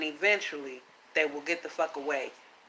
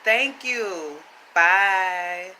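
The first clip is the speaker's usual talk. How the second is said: curt dismissive goodbye; 10 dB louder, much slower than usual. Acted. Curt send-off to viewers.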